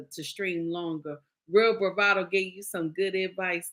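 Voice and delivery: usual speaking voice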